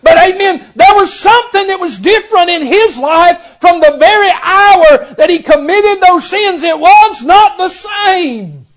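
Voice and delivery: high, loud preacher's cry, slowing at the end; full-force preaching. Acted. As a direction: preach it out with a crying edge.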